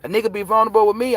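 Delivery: ordinary talk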